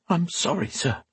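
high, soft, intimidated apology; shocked croak. A narrator's reading. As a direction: squeeze out a small, dry-mouthed apology. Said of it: "the words coming out as a croak".

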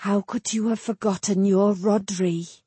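plain reading voice

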